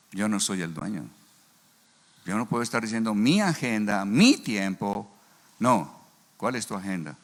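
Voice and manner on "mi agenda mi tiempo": "Mi" punched in both phrases; insistent, drawn-out emphasis on "mi"; stress on MI. Retold or imitated. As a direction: hit each "Mi" hard, drawing out "mi tiempo". Mocking his own self-centred claim.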